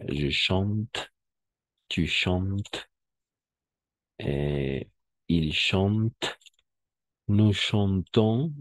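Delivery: je chante to nous chantons, drawn out; walk through the forms slowly; slow drill. Shown as a demonstration. Teacher drilling the conjugation with the class. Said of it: "vamos a hacer nuevamente ese ejercicio".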